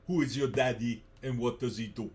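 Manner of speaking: channel the Austrian action star, line from a cop comedy; heavy Austrian accent bark; Arnold Schwarzenegger impression. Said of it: "I can do Arnold Schwarzenegger".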